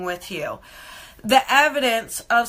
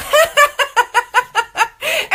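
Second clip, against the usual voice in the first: high, loud burst of giggles; long high-pitched laugh; real laughter; 12 semitones higher, 6 dB louder than usual. Real, not acted. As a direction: crack up at your own joke. Laughs at own joke.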